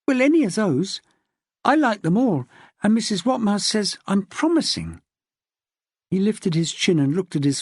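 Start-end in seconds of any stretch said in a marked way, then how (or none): 0.1-5.0 put-on higher child voice, keen and chatty; eager little boy. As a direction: play the young son, bright and eager. Young son Noel chatting eagerly.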